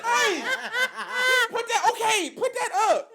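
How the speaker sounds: very high, loud, laughing mock scolding; the aunt telling the boy to put it away, high and laughing. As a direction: scold like an auntie while cracking up.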